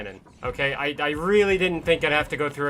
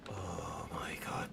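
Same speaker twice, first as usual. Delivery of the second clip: slow, whispered exasperation; despairing whisper; 10 semitones lower, 18 dB quieter, slower than usual. Real, not acted. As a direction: whisper it slowly, at a loss. Despairing at the game.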